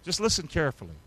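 ordinary delivery

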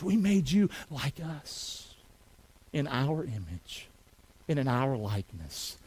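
slow, low and soft; hushed solemn quote. Acted. Preacher voices God describing creation, slow and hushed. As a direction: sink into a measured, reverent hush.

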